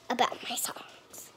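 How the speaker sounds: embarrassed whisper. Real, not acted. Girl admitting she forgets her songs, whisper. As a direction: trail off in a whisper.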